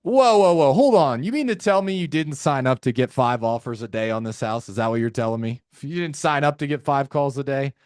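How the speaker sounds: playful tone. Shown as a demonstration. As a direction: kid the seller to disarm them. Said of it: "If you're playful with them".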